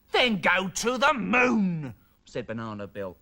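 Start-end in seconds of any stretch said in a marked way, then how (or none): 0.1-1.9 slow, higher, drawn-out order; petulant, emphatic command; angry demand. A narrator's reading. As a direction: stretch each word out imperiously. Son makes absurd angry demand.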